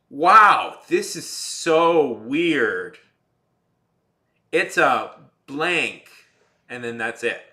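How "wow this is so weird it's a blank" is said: louder, higher, slowly drawn-out awe; mock amazement. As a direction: parody a lazy comic-book gag. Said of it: "where they just go like".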